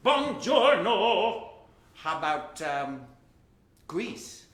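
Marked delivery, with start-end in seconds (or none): 0.1-1.5 Buongiorno stretched out slowly and boldly; slow hammed-up greeting. Shown as a demonstration. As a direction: ham up Buongiorno, slow and loud. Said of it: "let's go to Italy".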